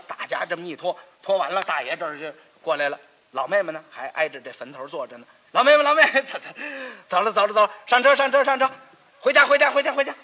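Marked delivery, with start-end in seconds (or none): 5.5-10.1 louder, higher urgent calling; elder brother calling his sister to get in the cart. Acted. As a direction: hurry her along, calling out. Calling sister to come home.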